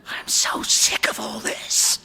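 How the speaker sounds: whispered outburst, straining with frustration; soft furious curse. A narrator's reading. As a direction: rage squeezed into a forceful whisper. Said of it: "Eric gives a soft".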